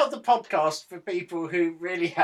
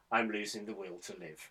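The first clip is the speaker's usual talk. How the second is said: quiet weary aside; 7 semitones lower, 12 dB quieter than usual. Real, not acted. Weary aside mid intro.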